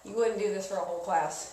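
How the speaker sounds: ordinary talk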